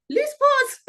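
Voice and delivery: a high, imitated British man's voice calling her name; British accent. Guide waking her up, imitated British accent. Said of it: "It was a guy".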